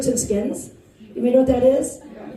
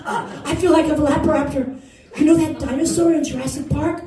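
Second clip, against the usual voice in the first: ordinary talk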